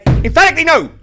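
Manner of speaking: shouted high and loud, with full emphasis; emphatic shout. Real, not acted. Host answering his own question with an emphatic shout. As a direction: slam the door on the idea. Host predicts Sharks result.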